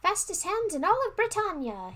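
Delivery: high, loud boast to the wizard; proud declaration. Acted. Prince boasting to the wizard, high and loud. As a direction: show off, proclaiming it loudly. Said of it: "he declared".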